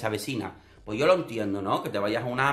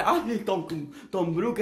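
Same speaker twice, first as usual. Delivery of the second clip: mock fawning, pitched higher; fawning giggly flatterer; 6 semitones higher, much slower than usual. Contestant laughing along with Tom, mock fawning and high. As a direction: gush over him like a smitten fan. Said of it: "Riéndole".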